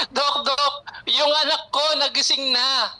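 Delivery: mother calling with news her son woke up, imitated with a tearful edge; imitating the crying mother. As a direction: echo the mother's emotional news, mildly. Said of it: "umiiyak po".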